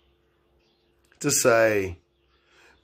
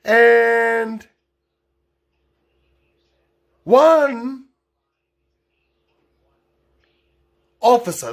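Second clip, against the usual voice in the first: booming intro; 10 semitones higher, 6 dB louder, much slower than usual. Acted. Dramatic story opener.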